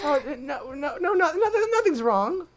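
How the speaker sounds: very high, stammering panic; flustered denial. Acted. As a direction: trip over it in a high nervous squeak. Heroine nervously denies being scared.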